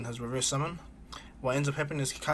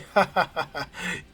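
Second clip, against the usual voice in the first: mocking laugh; 5 semitones higher, 5 dB louder than usual. Orochimaru and Tsunade laugh.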